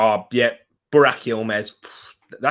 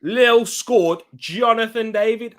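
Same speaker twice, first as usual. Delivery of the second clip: high-pitched, excited announcement; thrilled, high-pitched goal reaction; excited goal news; 10 semitones higher than usual. Real, not acted.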